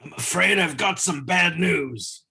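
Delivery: botched British impression, drifting Scottish, drawn out; Wade Barrett. Cohost greets the podcast with a wrestler's catchphrase, badly impersonated villain. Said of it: "That was a terrible impersonation".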